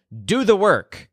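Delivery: high-pitched mock-angry order; mildly angry. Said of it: "Ser gets angry".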